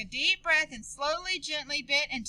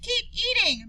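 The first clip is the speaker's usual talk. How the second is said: mild scolding; 8 semitones higher than usual. Acted. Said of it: "Maria said".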